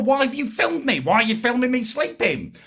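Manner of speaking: high-pitched mock female outrage; hammed-up impression of a woman freaking out; freaking-out girlfriend. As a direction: imitate her shrieky, accusing questions. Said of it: "she freaks out even more".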